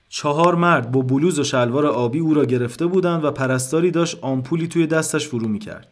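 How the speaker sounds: usual speaking voice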